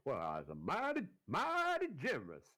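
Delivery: put-on stretched-out Southern accent leaping up high; hammed-up Southern accent, slow on the second "mighty". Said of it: "do a Southern accent".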